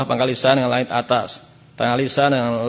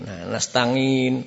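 normal talking voice

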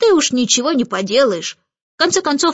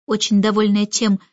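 plain reading voice

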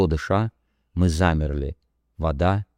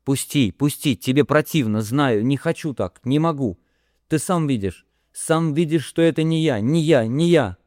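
higher, strained crying out; a pitched-up, anguished plea, half crying; tearless sobbing cry; 8 semitones higher than usual. Acted. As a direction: push him away, sobbing with no tears. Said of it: "рыдая без слёз, кричала".

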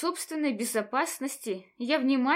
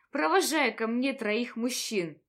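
usual speaking voice

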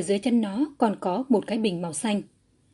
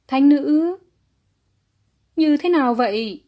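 higher, louder anxious trembling; 6 semitones higher, 7 dB louder than usual. A narrator's reading. Father asking the holy maiden about his son, trembling voice. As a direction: ask fearfully, voice trembling. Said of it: "nói với giọng run rẩy".

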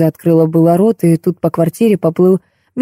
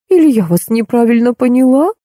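higher-pitched puzzled question; surprised; 6 semitones higher than usual. A narrator's reading. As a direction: wonder aloud, voice lifting. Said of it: "удивилась Роза Леопольдовна". (удивлённо, с повышением голоса)

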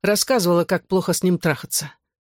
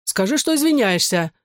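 higher-pitched insistent demand; mildly cross, insisting on an apology; 5 semitones higher than usual. A narrator's reading. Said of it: "сердито глянул он". (сердито)

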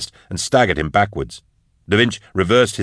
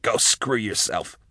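slow, soft defiance; defiant reply; 2 semitones higher, 6 dB quieter, slower than usual. A narrator's reading. Hostage answering her captor, quiet slow defiance. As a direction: spit it back slowly, without raising the voice. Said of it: "she replied".

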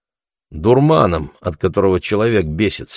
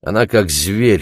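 ordinary delivery

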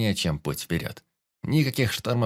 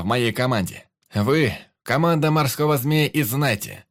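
raised, projected speech to the crew; rousing speech over the tavern; captain's address; 4 semitones higher, 4 dB louder than usual. A narrator's reading. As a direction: address the whole gathering from the heart.

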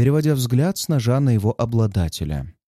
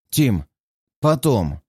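clenched-jaw curt order; through gritted teeth; slower than usual. Acted. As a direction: hold back anger, teeth shut. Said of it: "говорит капитан сквозь зубы". (сквозь стиснутые зубы)

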